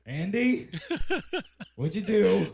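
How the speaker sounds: high-pitched call of mock blame; mock accusation. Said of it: "Be like".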